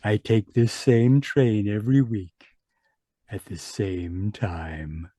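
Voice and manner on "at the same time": eerie whisper. Acted. Traveler's eerie parting words.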